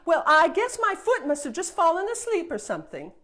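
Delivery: loud, quick, laughing cover-up; fibbing with a laugh. Acted. Princess lying about her stone foot, laughing loudly. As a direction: laugh it off to hide the lie. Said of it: "She really liked to lie".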